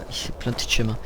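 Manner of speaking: quiet, low aside to oneself; low mutter. Real, not acted. Notices wrong turn, first take.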